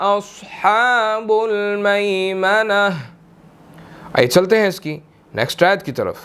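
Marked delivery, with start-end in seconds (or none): 0.1-2.9 very slow melodic tajweed recitation; Quran recitation. Shown as a demonstration. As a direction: draw each word out in chant. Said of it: "recites the verse, teacher". (धीमी सुरीली तिलावत)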